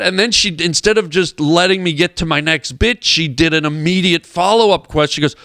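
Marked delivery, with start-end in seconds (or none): none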